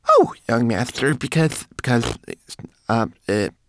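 high, loud, flustered stammer. A narrator's reading. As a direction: stumble over the words like a cornered little servant. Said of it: "stammered Twigleg".

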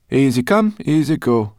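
very high, loud and bossy; a feisty brownie giving orders; Sorrel. A narrator's reading. Sorrel tells them to hide, high and loud.

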